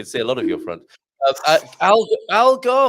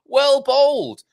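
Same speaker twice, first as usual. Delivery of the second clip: excited cheer; 11 semitones higher, 8 dB louder, slower than usual. Pretend. Cheering a bowling delivery.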